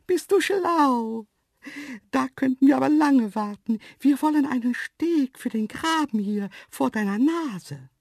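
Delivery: very high, loud, cheeky animal voice; mocking antelope. A narrator's reading. Antelope explaining the ditch bridge to the man, mocking. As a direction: tease him in a high antelope voice.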